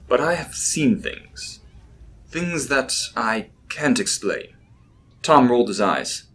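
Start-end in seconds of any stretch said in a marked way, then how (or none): 0.1-4.5 slow, measured words; slowly. Acted. Professor admitting to Tom what he has seen, slowly. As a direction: take it slow, weighing each word. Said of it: "he said slowly".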